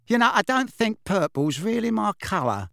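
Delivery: lightly pensive, thinking aloud. A narrator's reading. Witch studying her hair in mirror, pensive. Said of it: "she said pensively".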